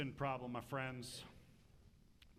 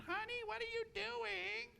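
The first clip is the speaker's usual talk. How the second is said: much higher, sweet nosy wife voice; curious wife. Retold or imitated. Wife asking husband what he's digging, high voice. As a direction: play the puzzled wife, light and high.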